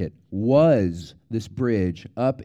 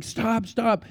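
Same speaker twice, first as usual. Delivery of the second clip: high-pitched mock yell at usual volume; retold urgent warning; 9 semitones higher than usual. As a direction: imitate calling out to stop traffic. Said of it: "running down the highway saying".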